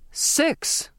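sharp high burst of alarm; captain alarmed at the count of names; shouted. Acted. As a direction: spit out the number in alarm. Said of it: "the captain shouted".

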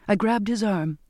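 ordinary delivery

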